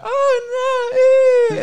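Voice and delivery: squealed high, loud "oh no ew"; mock kid's disgust. Little kids fleeing the fart, squealing in disgust. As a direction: shriek it like a grossed-out little kid. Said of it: "little kids would be like".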